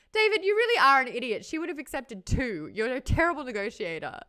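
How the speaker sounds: high, loud, fast scolding; mocking Nikolai. Nikolai mocks David's bargaining, loud and high. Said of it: "and Nikolai".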